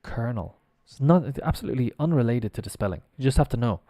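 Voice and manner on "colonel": "Colonel" said softly as KER-nul; correct pronunciation. Shown as a demonstration. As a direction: quietly model "Colonel" with an R, like "kernel". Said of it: "Like an R in there".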